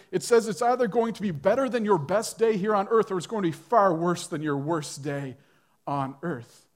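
ordinary talk

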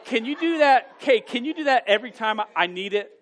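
laughing loudly, high and bright; asking his wife through loud laughter. Real, not acted. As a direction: burst out laughing while asking. Laughing at wife's snappy imitation.

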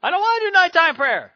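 put-on high, loud little boy's whine; whiny child refusing. Little son refusing his nighttime prayer, high whine.

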